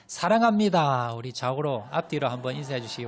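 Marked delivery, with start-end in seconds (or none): 0.1-1.1 louder, higher, slow and fervent; 사랑합니다 called out high and slow; loud declaration. Shown as a demonstration. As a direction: proclaim 사랑합니다 to everyone around. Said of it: "인사하실 때".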